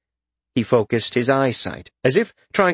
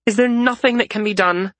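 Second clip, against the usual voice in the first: higher worried plea; pleading question; 9 semitones higher than usual. A narrator's reading. Frisha pleads with Rezkin for a remedy, higher and worried. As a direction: beg him for a way out.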